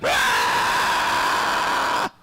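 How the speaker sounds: loud breathy scream of horror; mock scream. Seeing tentacles in a date's mouth, loud scream. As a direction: shriek at the tentacles.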